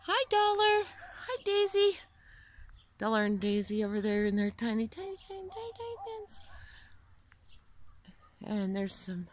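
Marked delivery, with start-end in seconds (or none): 0.0-2.0 very high cheerful greeting; high animal greeting. Real, not acted. 4.9-6.4 very high, very soft squeak; tiny squeaky voice. Real, not acted. Mocking tiny pens.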